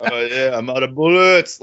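fake American accent. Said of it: "Dolph's fake American accent".

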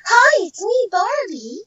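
high, cheerful doll greeting; perky toy-doll hello, pitched well up; Barbie's voice. Shown as a demonstration. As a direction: lift it into a sweet, high doll voice. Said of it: "which was me".

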